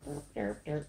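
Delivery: mock dull artist. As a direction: imitate a joyless artist plodding along. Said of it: "artists out there that they're just like".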